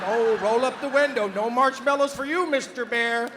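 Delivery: loud mock order; mock scolding. Officials telling car passengers to stop feeding bears, loud mock scolding.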